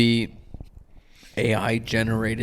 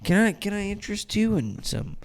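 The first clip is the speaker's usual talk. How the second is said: high, softer sales pitch; mock salesperson; 8 semitones higher, 5 dB quieter than usual. Store rep approaching a shopper about phone service, in a high mock voice. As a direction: imitate a timid-sounding pitch, voice lifted high.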